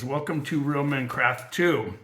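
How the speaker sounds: normal talking voice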